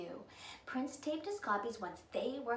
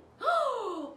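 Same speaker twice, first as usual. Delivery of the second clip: surprised gasp; 13 semitones higher, 8 dB louder than usual. A narrator's reading. Godmother arrives.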